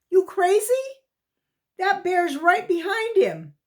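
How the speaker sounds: high, loud alarmed cry; panicked disbelief. A narrator's reading. Boy alarmed at the bear.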